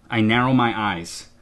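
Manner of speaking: ordinary delivery, nothing marked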